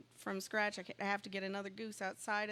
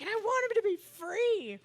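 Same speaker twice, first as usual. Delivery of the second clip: much higher and louder, a squeaky plea; pleading wish; 12 semitones higher, 9 dB louder than usual. Retold or imitated. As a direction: ask for it like a child begging, high-pitched. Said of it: "and I was like".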